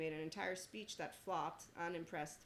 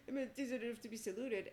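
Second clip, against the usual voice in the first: lightly put-on higher voice for a mocking quote; mock self-important voice; 6 semitones higher, 3 dB louder than usual. Imagining what they will think, mock self-important. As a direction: mimic them in a raised, put-on voice.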